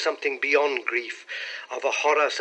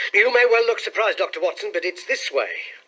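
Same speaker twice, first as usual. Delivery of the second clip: higher, louder, eager explaining; animated young clerk; 7 semitones higher, 6 dB louder than usual. A narrator's reading. Clerk explaining his surprise to Doctor Watson, animated. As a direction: lift the voice as the clerk explains himself.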